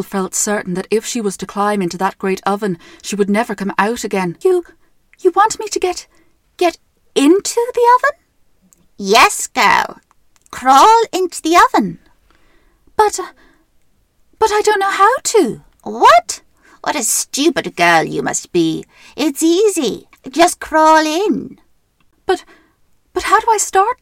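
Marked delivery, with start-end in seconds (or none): 4.4-8.2 put-on high, stammering scared girl; frightened girl. Frightened Gretel questions witch. 9.0-12.0 loud impatient witch. Acted. Witch insists. 13.0-15.6 put-on high, hesitant girl voice; timid girl. Gretel feigns ignorance. 15.8-21.6 loud, slow scornful witch; scolding witch. Acted. Witch scolds stupid girl. 22.3-24.0 high, fast faux-innocent girl. Gretel plays dumb.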